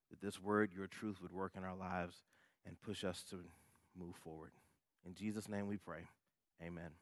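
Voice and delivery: soft, reverent prayer voice. Acted. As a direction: pray quietly to God.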